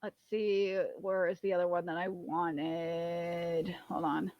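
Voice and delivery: slow, low, soft muttering. Real, not acted. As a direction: mumble to yourself. Muttering while searching.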